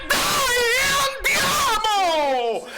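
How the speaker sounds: high, drawn-out cry of joy; victory shout. Real, not acted. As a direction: roar it out as the match ends. Final whistle, team wins. (urlo di gioia per la vittoria del Napoli)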